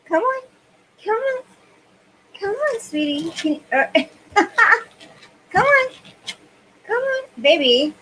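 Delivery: high, sing-song coaxing of the dog. Real, not acted. To her dog Piper on the floor, high coaxing come-on. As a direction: coax the little dog up, voice pitched high.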